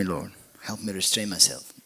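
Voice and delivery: ordinary delivery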